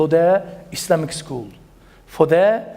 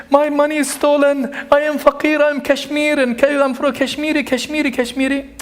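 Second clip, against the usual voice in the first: imitating a crying beggar; 8 semitones higher than usual. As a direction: plead like a beggar in tears, half-acted. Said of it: "And he's crying, literally crying".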